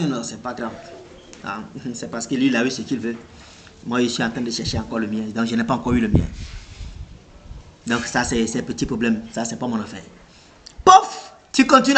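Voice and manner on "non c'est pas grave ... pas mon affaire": calm shrug-off. Acted. Acting calm when insulted.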